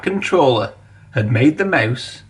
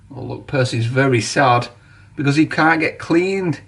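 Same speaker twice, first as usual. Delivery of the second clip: ordinary delivery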